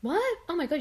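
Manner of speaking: high astonished what; surprised. Real, not acted. Learning Translate works offline, high amazed what. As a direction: react as if your mind is blown.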